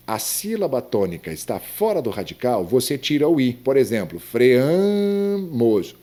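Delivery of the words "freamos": "freamos" with a long, high, loud A: fre-AAA-mos; "freamos" stretched hugely on the middle vowel; stress on the A of freamos. Shown as a demonstration. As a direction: hold and lift the A in "freamos". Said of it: "o a é forte".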